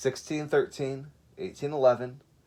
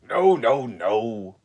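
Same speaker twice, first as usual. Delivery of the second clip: louder lion saying no; Lion objecting; 8 dB louder, much slower than usual. Acted. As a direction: wave off the others as Lion.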